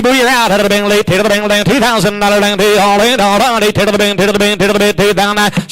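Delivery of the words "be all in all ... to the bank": ordinary talk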